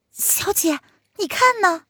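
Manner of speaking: put-on high girlish maid calling out; young maid voice. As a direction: call to your mistress, high and eager. Maid calls her young lady. (高声呼唤的小丫鬟)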